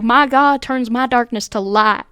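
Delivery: put-on slow, drawn-out Southern accent. Driver reading church sign aloud to mom, Southern accent. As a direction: read it with a Southern drawl. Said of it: "in, like, a Southern accent".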